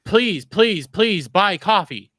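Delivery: loud, high-pitched hammed-up begging; mock pleading. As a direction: beg the customers in exaggerated desperation, stretching it out. Mock begging in imitated sales pitch.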